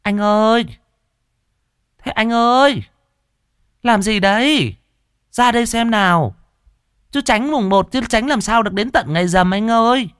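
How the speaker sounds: high, coaxing female voice calling out; pouting woman's voice. A narrator's reading. Mợ Hồng pouting, calling her man out in a high coaxing voice. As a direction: pout and coax him out, pitched high. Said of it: "Mợ Hồng chu mỏ lên".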